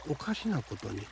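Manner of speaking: plain reading voice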